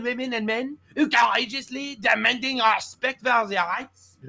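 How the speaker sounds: hammed-up feeble French accent; wimpy French accent. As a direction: read it as a slow, simpering French politician. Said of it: "my pussy accent. ... not actually my French accent".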